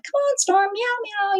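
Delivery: high, soft coaxing call; coaxing meow. Retold or imitated. Owner coaxing scared cat out, high and soft. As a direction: sweet-talk the cat out with meows. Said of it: "calling her".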